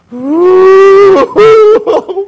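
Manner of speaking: long, high, loud boo-hoo; mock wail. Preacher imitates the boy crying over fallen dominoes, mock wail. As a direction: imitate a child bursting into sobs. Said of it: "막 우는 거야".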